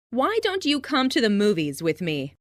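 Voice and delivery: ordinary delivery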